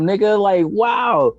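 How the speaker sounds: normal talking voice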